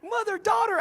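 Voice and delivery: very high, excited imitation; hammed-up high-pitched elation; ecstatic acceptance. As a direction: squeal it in amazed delight. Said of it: "and they said".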